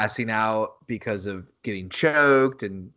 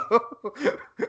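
short high-pitched laugh; 11 semitones higher than usual. Real, not acted. Joking about ring names, real high laugh. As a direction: giggle at your own joke.

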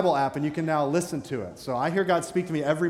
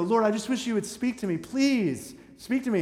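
higher-pitched imploring prayer; mock pleading; 6 semitones higher than usual. People pleading with the Lord to speak, mock pleading. Acted plea of people praying.

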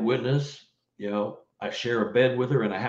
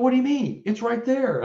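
baffled wife; 13 semitones higher, 3 dB louder than usual. Retold or imitated. Wife insists car is there.

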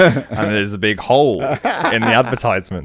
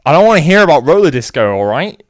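loud, higher angry snap; angry outburst; 6 semitones higher, 8 dB louder than usual. Acted. Will snapping at his friends about roller disco, angry shout. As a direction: slam the words down in frustration. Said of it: "Will slams his hand on the table and says".

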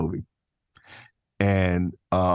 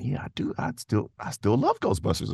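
ordinary delivery

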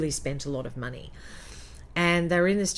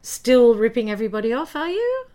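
loud, high sarcastic taunt; sarcastic loud greeting; mocking jab; 6 semitones higher, 7 dB louder than usual. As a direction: needle him loudly with a sneer. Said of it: "And I just said to him".

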